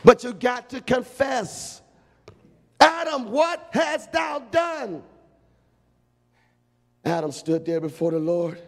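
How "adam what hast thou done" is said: thundering divine summons; booming call. Acted. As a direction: call him out from on high. God calling Adam in judgment.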